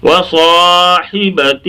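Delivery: slow chant of وصاحبتہ with long saa; stretched recitation. Shown as a demonstration. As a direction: hold saa and final hee in وصاحبتہ, hi short. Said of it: "ہی نہیں کھینچنا".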